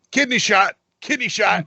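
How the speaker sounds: high-pitched mock anime scream; imitated scream. Re-enacting Frieza getting hit by Goku, mock screaming. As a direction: yelp it out like a cartoon villain taking a hit. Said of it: "hit by Goku and screaming".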